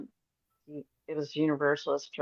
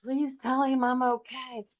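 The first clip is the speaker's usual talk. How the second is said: slow, gently raised pleading voice; voicing the dead mother; 7 semitones higher than usual. Dead mother begging the medium to reassure her son, slow and gentle. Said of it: "And Mom's like".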